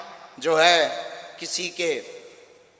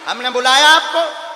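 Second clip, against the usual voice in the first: raised, loud rebuke of a journalist; angry scolding; 7 semitones higher, 9 dB louder than usual. Real, not acted. Guru scolding the reporter who interrupted him, loud and angry. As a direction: tell him off loudly for interrupting.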